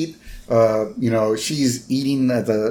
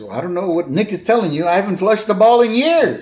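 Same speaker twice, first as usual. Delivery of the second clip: high, loud, emphatic protest, rising to the end; exasperated store guy; 7 semitones higher, 4 dB louder, faster than usual. Retold or imitated. Bowling shop guy brushing off a customer, exasperated and loud. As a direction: protest it louder and higher as you go.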